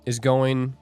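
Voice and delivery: normal talking voice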